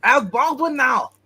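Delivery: loud, high mock German accent. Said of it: "Anybody know a German accent?".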